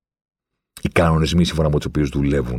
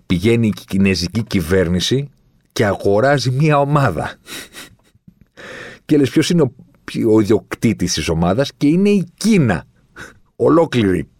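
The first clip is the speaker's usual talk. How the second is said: high, raised voice in disbelief; worked up and astonished, pitch soaring; incredulous outburst; 7 semitones higher, 3 dB louder than usual. Real, not acted. Incredulous at a state buying clubs.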